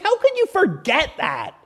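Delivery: high, incredulous angelic scolding; oozing with attitude. Acted. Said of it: "they're just oozing with attitude".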